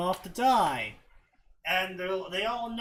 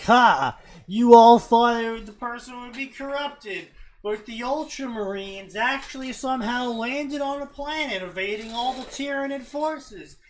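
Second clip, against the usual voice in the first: higher hammed-up villain-style reveal, opening loud; mock gloating reveal; 6 semitones higher, 7 dB louder than usual. Reader imitating the author springing a twist, mock gloating. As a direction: crow the twist with exaggerated triumph.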